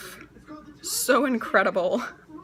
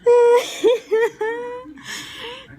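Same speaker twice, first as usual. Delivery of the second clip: slow, real tearful laughter; laughing till crying; 9 semitones higher, 4 dB louder, much slower than usual. Real, not acted. Daughter admitting her blunder, crying with laughter. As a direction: gasp it out through helpless laughs.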